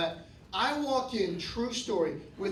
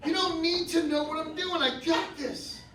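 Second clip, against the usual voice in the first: put-on defiant kid; 7 semitones higher, 5 dB louder than usual. Kid voice to parents.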